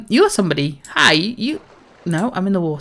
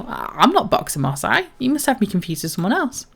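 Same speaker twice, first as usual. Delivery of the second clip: ordinary talk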